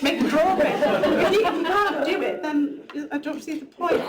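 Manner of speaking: a laughing, slowed outburst at lazy artists; half-joking exasperation with a laugh; laughing rant. Real, not acted. Laughing at her own rant.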